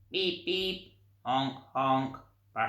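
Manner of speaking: honking horn imitation, playful; comic horn sounds for children; horn noises. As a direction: beep and honk like toy horns. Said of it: "went Missus Armitage on her horns".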